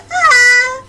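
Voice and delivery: high, loud crying shriek; anguished scream. Acted. Dragged child, screaming in anguish. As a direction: scream in despair.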